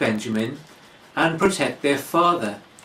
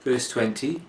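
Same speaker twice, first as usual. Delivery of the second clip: ordinary talk, nothing marked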